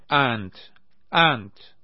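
calling out. A narrator's reading. Naser points at a prisoner.

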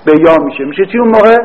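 ordinary talk

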